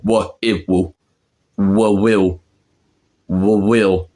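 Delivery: "will" pieced together from "wa, I, wo"; Cockney pronunciation. Shown as a demonstration. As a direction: build "will" with a w in place of L. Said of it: "how they say it in Cockney".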